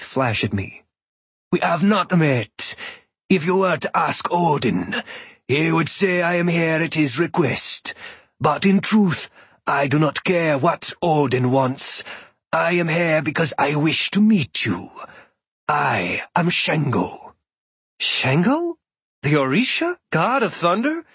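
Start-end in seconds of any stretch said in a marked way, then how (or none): 1.5-17.3 a hint of a proud god introducing himself; unhurried, resonant-toned foreign god, lightly done; calm thunder god. Shango speaks, held figure voice. 18.0-21.0 surprised recognition. Acted. Narrator recognizes the god.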